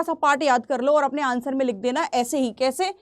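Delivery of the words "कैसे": ordinary delivery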